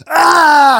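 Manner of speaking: high excited yelp; a sudden squeal of delight; mock scream of excitement; retold scream. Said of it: "I just scream".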